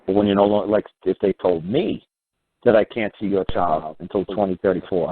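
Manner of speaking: normal talking voice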